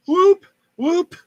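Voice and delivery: high hooting whoop call; bigfoot whoop. Retold or imitated. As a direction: mimic a pathetic Bigfoot whoop. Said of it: "the guy went like this".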